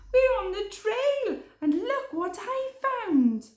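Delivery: high, loud thrilled voice; excited. Acted. Hare showing Podgy what he found, excited. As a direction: bubble over with the discovery. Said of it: "cried Hare excitedly".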